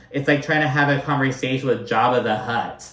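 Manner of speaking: ordinary talk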